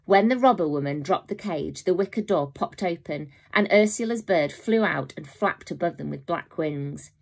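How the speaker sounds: ordinary delivery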